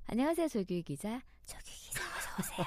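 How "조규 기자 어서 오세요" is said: hushed welcome; playful whisper. Pretend. Host welcoming her co-host, whispering. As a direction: whisper a greeting to your co-host. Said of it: "제가 속삭였어요".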